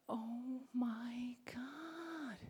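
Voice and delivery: very slow, breathy realisation; sudden dawning whisper; stunned whisper. Retold or imitated. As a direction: whisper it as it dawns on you. Memory hits at red light.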